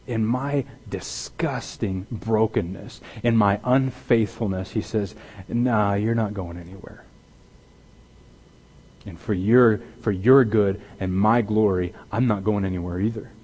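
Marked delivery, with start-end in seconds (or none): 5.5-13.3 softer, easy reassuring voice; God reassuring. Pretend. God telling the broken believer he stays, soft and reassuring. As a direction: settle them gently, no way I'm leaving.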